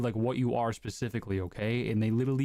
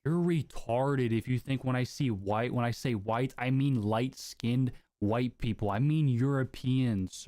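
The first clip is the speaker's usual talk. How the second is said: slow, deliberate; slower than usual. Real, not acted. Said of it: "slow explaining".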